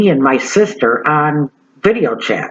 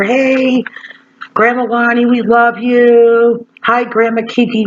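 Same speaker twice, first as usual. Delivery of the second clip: cheerful grandkids' greeting; 8 semitones higher, slower than usual. Pretend. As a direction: sing out like excited grandchildren. Imagining grandkids greeting on video.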